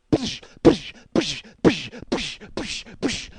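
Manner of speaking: sharp, explosive "psh" bursts; rhythmic mouth-made impact sounds; imitated punching noises. Storyteller showing himself punching the phone, explosive impact noises.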